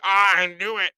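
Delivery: high, loud burst of vindication; hammed-up gloating; mock triumph. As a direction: crow it as if proven right. Acts out gloating at the news.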